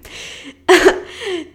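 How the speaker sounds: a short, embarrassed laugh through the emotion; a real laugh that breaks the tension. Real, not acted. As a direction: laugh off the tears. Laughs at her own emotion.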